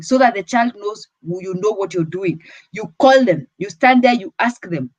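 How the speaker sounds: ordinary talk, nothing marked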